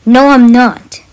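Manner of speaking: sharp loud retort; snapping back. Acted. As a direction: snap it back defiantly. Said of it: "I snapped back".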